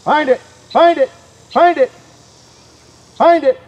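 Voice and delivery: high, loud repeated shout; shouted command. Real, not acted. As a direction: yell it across the field.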